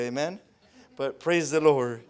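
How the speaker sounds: normal talking voice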